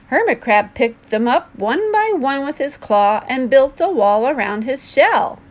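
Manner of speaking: ordinary delivery, nothing marked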